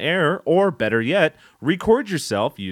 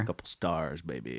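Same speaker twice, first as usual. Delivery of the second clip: low joking mutter; 7 semitones lower, 12 dB quieter than usual. Pretend. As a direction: drop very low and soft, teasing.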